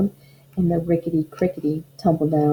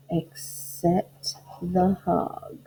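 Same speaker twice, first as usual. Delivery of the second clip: slow and hushed; 6 dB quieter, much slower than usual. Acted.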